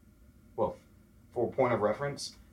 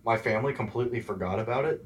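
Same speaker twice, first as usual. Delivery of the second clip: normal talking voice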